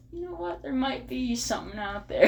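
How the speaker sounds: high, wondering realisation; dawning wonder. Retold or imitated. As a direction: sound struck by a new idea. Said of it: "I was like".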